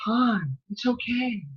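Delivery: soft imitation of Princess Leia; imitating Leia. Mocking Leia to Han, softly. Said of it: "the way she talked too".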